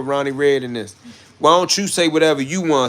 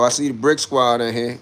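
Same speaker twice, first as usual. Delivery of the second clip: usual speaking voice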